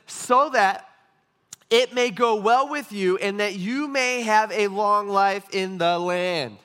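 slow, drawn-out verse reading; measured, weighty recitation; emphatic scripture reading. Acted. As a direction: read the promise out slowly, stretching the last words. Reads out the promise from scripture.

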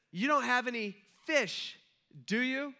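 high, slowed question called from shore; retold calling out. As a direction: call out a teasing question to fishermen. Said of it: "he yells out to these grown men".

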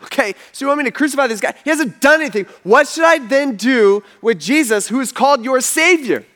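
high-pitched, incredulous appeal; worked-up, disbelieving questions; exasperated questioning. Retold or imitated. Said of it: "So Pilate asks him, he says".

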